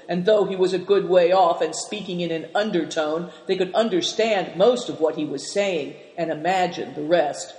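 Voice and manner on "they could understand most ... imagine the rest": ordinary delivery, nothing marked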